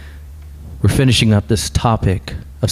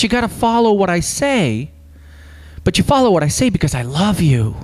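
higher, pleading voice of a loving God; voicing God pleading; 5 semitones higher than usual. Pastor voices God to the congregation, higher pleading voice. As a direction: plead as God longing for his people. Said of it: "but God more importantly says".

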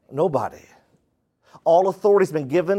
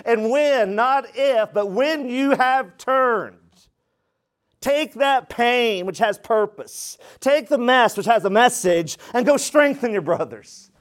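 higher, emphatic, deliberate phrases; raised preacher's charge; preaching exhortation; 7 semitones higher, slower than usual. Real, not acted. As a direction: urge the congregation on, phrase by phrase.

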